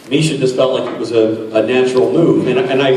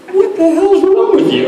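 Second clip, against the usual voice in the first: much higher, laughing mock incredulous voice; puzzled prop girl. Prop girl Robin quizzing the nervous actor, much higher and incredulous. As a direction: play her baffled, half-laughing. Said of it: "Robin was like".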